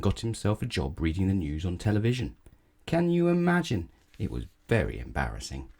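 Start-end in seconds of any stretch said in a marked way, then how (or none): none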